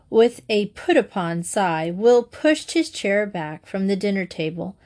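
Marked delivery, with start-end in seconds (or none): none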